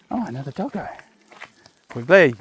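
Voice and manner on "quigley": firm call to the dog. Real, not acted. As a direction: call the dog's name loud. Calling the dog.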